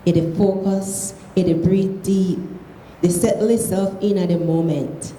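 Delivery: reading aloud in Krio; Sierra Leone Creole. Shown as a demonstration. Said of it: "read it in Creole".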